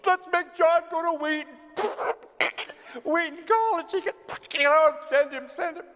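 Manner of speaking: very high, far-off giggling voice of Chesterton up in heaven, begging God; Chesterton laughing. Retold or imitated. Chesterton begs God to send John to Wheaton, high and giggly. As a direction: squeal it out like a giddy old man tugging at a robe. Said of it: "Chesterton's laughing hysterically, tugging on God's robe".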